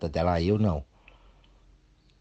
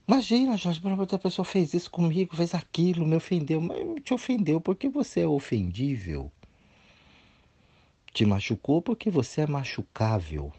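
high, put-upon voice of someone offended; whiny offended voice; 10 semitones higher than usual. Retold or imitated. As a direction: play the wounded victim, pitched well up. Imitating an offended dramatic person.

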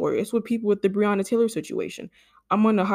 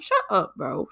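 exasperated shut up; slower than usual. Acted. Cutting off an imagined commenter, exasperated. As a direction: snap it at the critic.

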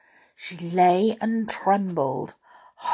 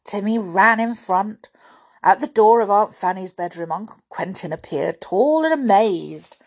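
usual speaking voice

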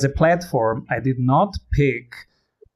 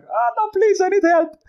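very high, hammed-up plea; squeaky-high mock cry for help; mock pleading. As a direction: imitate a desperate customer begging for help. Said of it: "It was more like".

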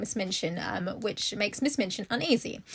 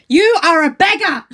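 loud, higher contemptuous pronouncement; harsh headmistress; 6 semitones higher, 14 dB louder than usual. A narrator's reading. Headmistress telling orphan she is poor: loud contempt. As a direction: spit the word beggar at her. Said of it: "said Miss Minchin".

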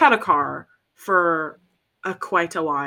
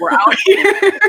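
rallying shout; 13 semitones higher, 9 dB louder, slower than usual. Acted. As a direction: belt it out, high and slow. Proud rallying cry, "in these streets.

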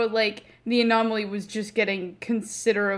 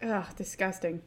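mock disgust, soft; a playful grossed-out groan; annoyed ugh; 8 dB quieter than usual.

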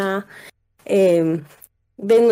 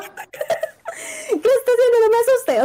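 high, slow, laughing through fright; nervous laughter; slower than usual. Retold or imitated. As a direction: laugh it off while scared. Scared friend laughs it off.

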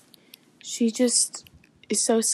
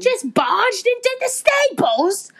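higher, grumbling Yorkshire servant; thick Yorkshire accent; 6 semitones higher than usual. Acted. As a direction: complain in broad Yorkshire. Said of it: "That is one thick Yorkshire accent".

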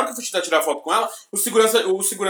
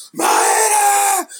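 high shouted name, squealing fan; fan scream; 12 semitones higher, 3 dB louder than usual. Retold or imitated. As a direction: shriek her name like a starstruck fan. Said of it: "Eu comecei a berrar". (grito de fã, agudo e alto)

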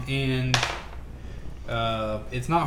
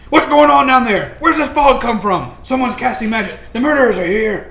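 loud, raised alarm from above; higher, louder panicked guard voice; guards calling down; 7 semitones higher, 9 dB louder than usual. Acted. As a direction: yell down from the tower in alarm. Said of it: "the guards in the towers above are saying".

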